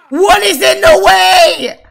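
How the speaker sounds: high, loud exasperated yell; frustrated shout. Real, not acted. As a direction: yell at them to move. Yelling at Sims blocking wedding.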